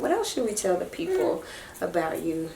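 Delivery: usual speaking voice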